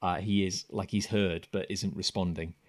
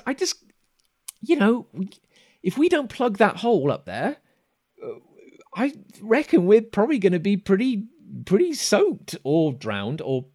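high, louder worried voice; pleading buddy; 9 semitones higher, 7 dB louder than usual. Acted. Okus warning his buddy about the unplugged hole, high and hesitant. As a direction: reason anxiously in a high character voice.

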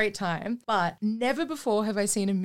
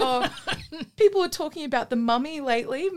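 raised pitch, amused studio-exec chatter; mock producer pitch; 6 semitones higher, 4 dB louder than usual. Producer casting whoever people are talking about, mock eager. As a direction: pitch the casting idea through laughter.

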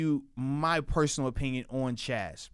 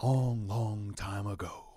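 slow, soft storyteller voice; hushed once-upon-a-time delivery; fireside storytelling; 6 semitones lower, 6 dB quieter, slower than usual. Pretend. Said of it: "certain people got a storytelling voice".

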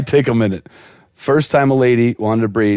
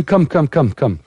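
higher, lightly voiced Russian woman; Russian accent; 4 semitones higher than usual. Russian vet calling the owner in, higher Russian accent. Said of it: "vet with a Russian accent".

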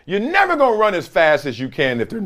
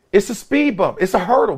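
usual speaking voice

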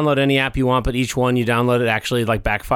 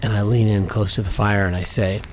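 normal talking voice

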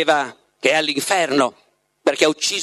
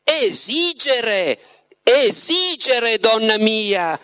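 higher, very slow, indulgent; understanding smile; 6 semitones higher, much slower than usual. A narrator's reading. Voland correcting Margherita with an indulgent smile, slow. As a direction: savour each word with knowing kindness. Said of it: "con un sorriso di comprensione".

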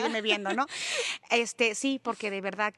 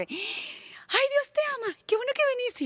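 gushing grinning youths; 3 dB louder than usual. Retold or imitated. Retreat youths greeting a newcomer, gushing parody. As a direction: squeal it sweetly through a huge smile. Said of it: "porque siempre con la sonrisa de sandía".

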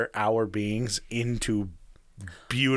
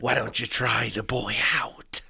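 whispered creepy Dafoe-style villain; Willem Dafoe impression. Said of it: "Now say it like Willem Dafoe".

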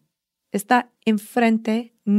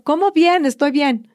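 mock complacent; 5 semitones higher, 6 dB louder than usual. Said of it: "es como".

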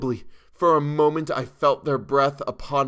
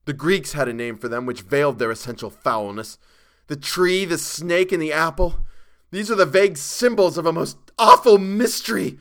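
starting restrained, building to a high, loud cry; rising to scream; 5 semitones higher, 7 dB louder than usual. A narrator's reading. Chalmers describing the awful creatures, voice rising to a scream. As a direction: build steadily from hush to near scream. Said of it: "His voice had risen to a scream".

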